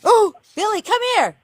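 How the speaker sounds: very high, urgent holler; high-pitched summons to a cat; calling a pet. Real, not acted. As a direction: call the cat in from outside. Calling cat home from fight.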